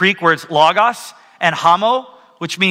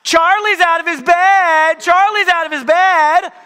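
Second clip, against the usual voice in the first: put-on a sly kid shouting down the stairs to frame her brother; mock girl tattling at full voice; little girl yelling; 10 semitones higher than usual. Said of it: "Brinley yells down".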